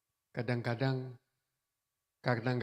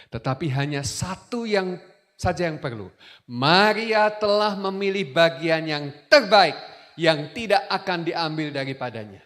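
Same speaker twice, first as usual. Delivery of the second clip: full-force preaching; 5 semitones higher, 13 dB louder than usual. Acted. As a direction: proclaim it to the whole hall. Preaching scripture reading.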